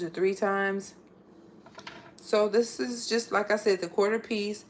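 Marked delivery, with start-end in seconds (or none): none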